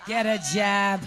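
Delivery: low, put-on American accent. Shown as a demonstration. Panelist showing off an American accent for a fan, low. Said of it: "give us your best American accent".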